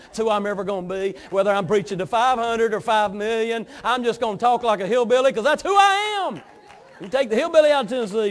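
loud, high defiant pulpit cry; full-force preaching. Real, not acted. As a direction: build to a shouted declaration of who you are.

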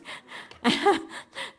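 a breathless, airy burst of laughter; delighted laugh. Real, not acted. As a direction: laugh in happy disbelief at the surprise. Laughing at surprise gift.

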